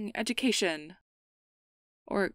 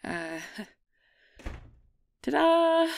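high, slow, louder reveal with a laugh; 10 semitones higher, 9 dB louder, slower than usual. Acted. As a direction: present it like a proud magic trick. Unveiling the decorations.

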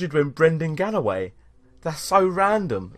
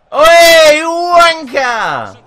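very high, very loud drawn-out heckle; angry yell; 13 semitones higher, 10 dB louder, much slower than usual. Real, not acted. Gamer abusing a player on screen, angry yell. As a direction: bellow it like a furious football fan. Yells abuse at the game.

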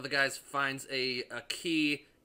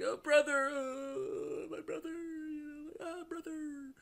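very high, hammed-up fake wail; mocking the actress crying over her brother; slower than usual. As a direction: overplay a whiny sob. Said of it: "she's crying".

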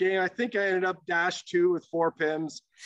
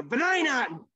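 high-pitched imitation of a young fan; mock kid's yell, pitched up; heckling kid; 7 semitones higher than usual. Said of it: "there's this kid".

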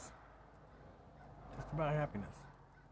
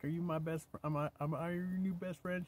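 playful sweet talk; 7 semitones higher than usual. Real, not acted. Grandpa to granddaughter, playful higher coo.

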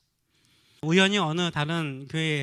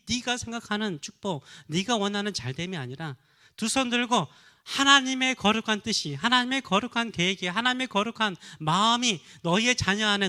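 louder, higher impassioned sermon build; fervent preaching; 4 semitones higher, 4 dB louder than usual. Acted. Pastor exhorting parents to pray for their children, impassioned. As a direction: preach it with rising passion.

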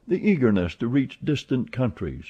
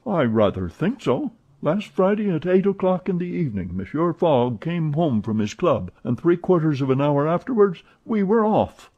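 a hint of an eager servant, higher; lightly voiced valet; 7 semitones higher than usual. Passepartout chatting to Fix, lightly voiced.

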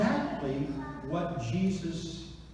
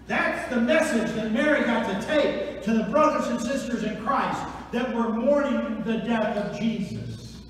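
ordinary delivery

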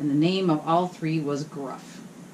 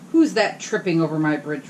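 mild troll roar; 4 dB louder than usual. Acted. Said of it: "roared the troll".

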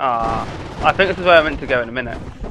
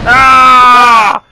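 loud startled yell; loud shout; 7 semitones higher, 11 dB louder than usual. Real, not acted. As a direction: cry out as the bomb blows. Bombs explode.

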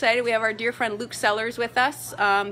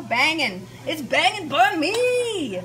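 higher, slow, drawn-out delight; excited praise; 6 semitones higher, 5 dB louder, slower than usual. Real, not acted. Host praising her banh mi, slow and delighted. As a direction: savour every word.

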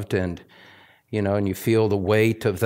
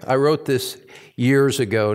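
usual speaking voice

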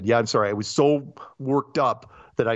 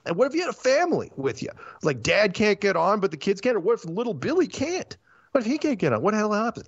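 higher and fast, piling up outraged questions; exasperated rant, pitch up, speeding along; worked-up rant; 9 semitones higher, faster than usual. Real, not acted. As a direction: get more and more indignant with each what-if. Worked-up rant about airport checks.

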